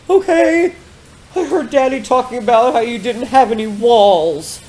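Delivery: high-pitched put-on upset kid voice; crying child. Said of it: "had her son call her crying".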